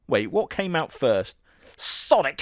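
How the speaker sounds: ordinary talk, nothing marked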